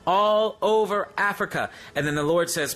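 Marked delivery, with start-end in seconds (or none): 0.1-1.7 proclaiming shout. Retold or imitated. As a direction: announce it like God shouting over a continent. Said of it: "He just started shouting that".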